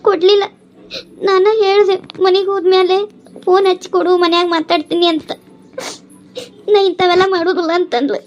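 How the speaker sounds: tearful, hurt complaint; crying. Acted. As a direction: pour out your hurt while crying. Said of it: "ನಿನಗೆ ಅಳು ಬರಾಕತ್ತೈತೇನ".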